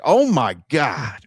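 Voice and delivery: retold gushing exclamation; awed reaction. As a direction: stretch it out like a starstruck fan. Retells own amazed reaction to signature.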